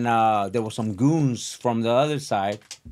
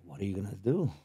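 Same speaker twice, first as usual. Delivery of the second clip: quiet goading challenge; 4 semitones lower, 9 dB quieter, faster than usual. Retold or imitated. Bill challenging Jeremy.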